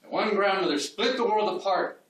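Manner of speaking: measured poem recital, pitch lifting on key lines; declaimed verse with pauses between lines; poetry reading. Acted. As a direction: read it aloud as a poem to a room, each line set apart.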